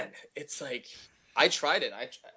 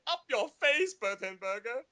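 much higher, cartoon character voice; cartoon character catchphrase; 13 semitones higher than usual. Voice actor replays his character's taunt to the neighbor in a much higher cartoon voice. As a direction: fire off the catchphrase like the gloating kid character. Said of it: "his catch line".

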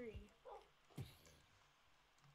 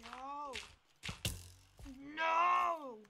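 much louder desperate no; loud shout; 3 semitones higher, 10 dB louder than usual. Real, not acted. Player losing again, loud desperate no. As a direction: yell it out.

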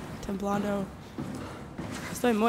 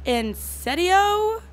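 incredulous drawl; 9 semitones higher, 4 dB louder, much slower than usual. Real, not acted. Annoyed by game character's demand.

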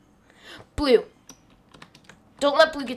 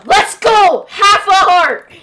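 excited cheer; 6 semitones higher, 8 dB louder, much slower than usual. Real, not acted. Enemy nearly dead, cheering.

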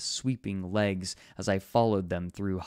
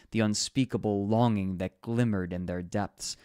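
plain reading voice

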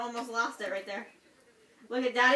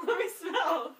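laughing; 4 semitones higher, much slower than usual. Real, not acted. Laughing at friend's breath.

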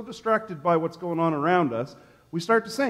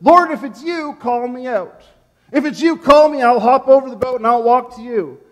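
eager call; 5 semitones higher, 5 dB louder than usual. Acted. Peter calling to Jesus.

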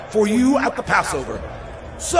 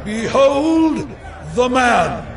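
high, drawn-out call; public proclamation; much slower than usual. Acted. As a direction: present him to the crowd. Pilate presenting Jesus to crowd.

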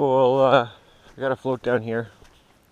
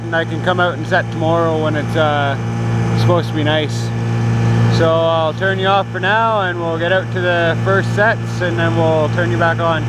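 loud, higher voice over engine noise; talking over noise; 4 semitones higher, 10 dB louder than usual. Real, not acted. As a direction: keep projecting over the motor. Talking over boat motor outdoors.

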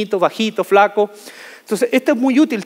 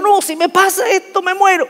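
mock panic; 12 semitones higher than usual. Fearful inner voice, before it happens.

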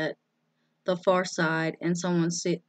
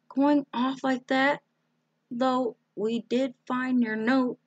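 higher-pitched motherly fuss over a daughter; fussing, concerned mother; 6 semitones higher than usual. A narrator's reading. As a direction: greet them in a high, concerned mother's voice. Voices Sarah's fussing mother.

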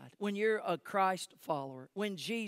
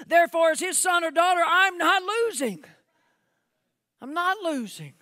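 high, loud preaching with stretched words; full-force preaching; 9 semitones higher, 10 dB louder than usual. Real, not acted. Preacher urging church to speak faith, full-force preaching.

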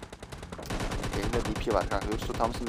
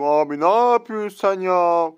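indignant; 5 semitones higher, 6 dB louder, slower than usual. Real, not acted. Slow indignant complaint.

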